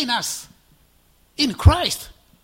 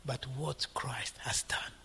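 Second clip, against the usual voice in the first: slow, soft whisper, much lower; whispered quote; 7 semitones lower, 14 dB quieter than usual. Retold or imitated. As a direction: hush it like a secret. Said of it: "he always concludes".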